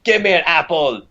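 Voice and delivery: put-on failed Scottish accent. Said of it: "everything comes off British".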